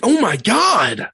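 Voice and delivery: high, loud, slow mock-shocked oh my god; overdramatic gasp. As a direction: ham up the wife's overreaction to her phone. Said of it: "she'll be like".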